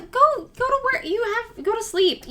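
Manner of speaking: high, playful push to go to bed; playful high shooing. Real, not acted. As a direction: chase him off to sleep in a high, teasing voice.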